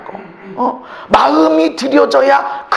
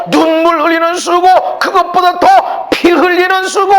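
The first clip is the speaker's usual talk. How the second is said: usual speaking voice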